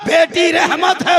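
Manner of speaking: loud, high, slow sermon cry; preaching at full force. Acted. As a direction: proclaim "बेटी रहमत है" slowly, high and loud.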